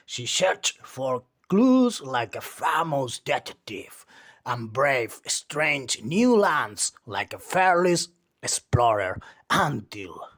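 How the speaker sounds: ordinary delivery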